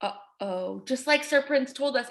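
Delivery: ordinary talk, nothing marked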